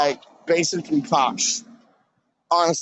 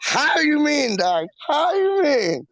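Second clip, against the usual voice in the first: much higher, playful; high catchphrase; 8 semitones higher than usual. Acted.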